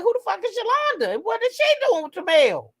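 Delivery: high, incredulous acted questions; retold bafflement. Host retelling confusion before reading comments, baffled. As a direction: pitch it way up in mock confusion.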